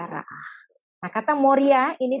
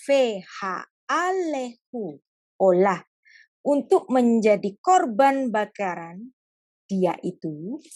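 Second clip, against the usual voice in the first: very slow, drawn-out reading; God slowly giving a painful command; slow gentle; much slower than usual. Acted. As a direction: spell out the heavy order slowly. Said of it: "Ngomongnya pelan-pelan".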